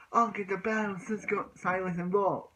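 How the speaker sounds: high, cocky rival voice; mock-cocky anime kid; boastful rival. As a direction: brag like a cartoon rival listing who he will beat. Rival boasting, imitated in story.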